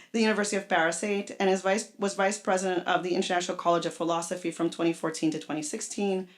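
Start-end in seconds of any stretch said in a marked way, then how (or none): none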